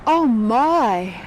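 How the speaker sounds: loud startled "Oh, my"; startled gasp. Acted. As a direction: caught off guard, blurt it out loud. Sudden shocked exclamation.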